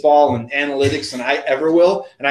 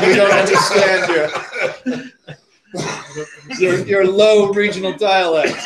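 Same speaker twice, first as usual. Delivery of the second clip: higher mock-snooty voice, laughing; well-to-do Yankees; 6 semitones higher, 3 dB louder than usual. Co-host mocking Yankees baffled by Southern speech, haughty and laughing. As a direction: play haughty Northerners through laughter. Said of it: "You're like".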